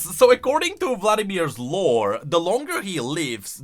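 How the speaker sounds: ordinary delivery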